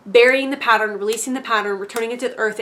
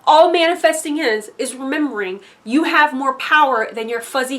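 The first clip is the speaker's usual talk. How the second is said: normal talking voice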